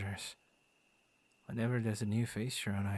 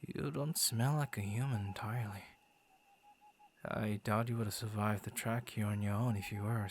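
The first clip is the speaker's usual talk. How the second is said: usual speaking voice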